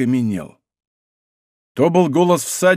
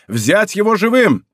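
higher, urgent command; shouted order; 6 semitones higher, 3 dB louder than usual. A narrator's reading. Soldiers spotting the supposed king, shouted order. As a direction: cry out the order to capture.

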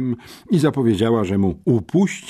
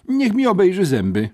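high, despairing schoolboy plea; desperate; 12 semitones higher, 4 dB louder than usual. A narrator's reading. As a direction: offer yourself up in despair, voice high. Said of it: "wyznał rozpaczliwie". (rozpaczliwie, wysokim głosem)

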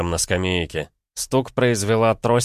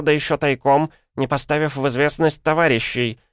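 put-on high, loud scolding old woman; piercing, insistent old-woman voice; дребезжащая старуха; 9 semitones higher, 4 dB louder than usual. A narrator's reading. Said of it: "Дребезжащий старушечий голос". (дребезжащий старушечий голос, высоко и с напором)

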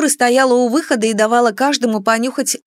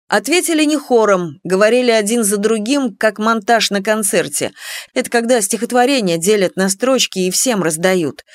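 plain reading voice

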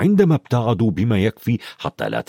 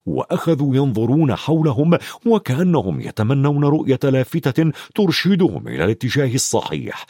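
usual speaking voice

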